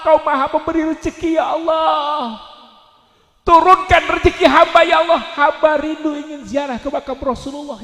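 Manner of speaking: loud, high acted plea to God; hammed-up begging prayer; impassioned prayer. Acts out tearful night prayer aloud.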